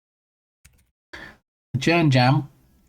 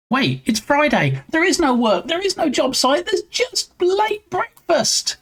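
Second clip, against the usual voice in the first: delighted realization; slower than usual. Real, not acted. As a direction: pitch climbing higher and higher, slow at the end. Realizing it is Friday, no work.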